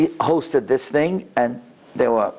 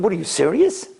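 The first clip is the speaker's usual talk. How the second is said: higher, incredulous question; disbelieving; 5 semitones higher than usual. Retold or imitated. Patient hearing a sudden kidney offer, disbelieving. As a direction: react as if you can't believe it. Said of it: "she couldn't believe it".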